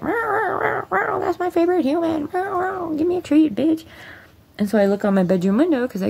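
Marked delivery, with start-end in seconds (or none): 0.0-3.8 much higher squeaky pet voice. Pretend. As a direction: voice the dog as a greedy little beggar. Dog voiced by owner.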